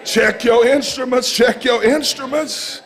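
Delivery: rousing, rhythmic sermon delivery; fired-up preacher working the crowd; full-force preaching. Real, not acted. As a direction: preach it out to the congregation, driving each phrase.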